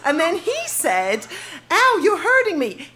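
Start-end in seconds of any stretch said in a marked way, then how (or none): 1.7-2.9 lightly imitated Canadian accent, higher pitched; cute Canadian accent. Teen diarist quoting her Canadian crush, cute Canadian accent. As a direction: mimic a cute Canadian boy's complaint. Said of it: "in a very cute accent".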